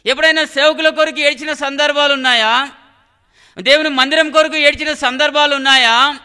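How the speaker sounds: loud, ringing challenge from the pulpit; full-force preaching. Real, not acted. Preacher challenging believers to weep for God's work, full-force preaching. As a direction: hammer each question out, building to a shout.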